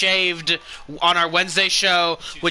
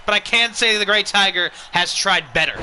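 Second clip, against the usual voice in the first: normal talking voice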